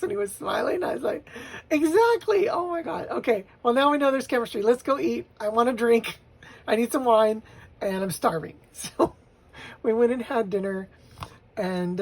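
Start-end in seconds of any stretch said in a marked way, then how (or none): 1.7-8.6 high, loud and fast with giddy excitement; giddy rush after the first kiss; excited. Retold or imitated. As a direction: burst out thrilled and breathless.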